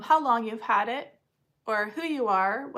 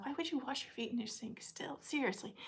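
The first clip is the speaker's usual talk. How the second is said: soft whispered remark; whispered incredulous aside; 12 dB quieter than usual. Real, not acted. Aside about mom.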